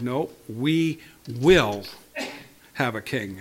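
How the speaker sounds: "will" leaned on hard and slow; "No, we will have a king" with the weight on "will"; stress on will. Shown as a demonstration. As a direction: hit the word "will" with insistence. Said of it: "I put the emphasis on will".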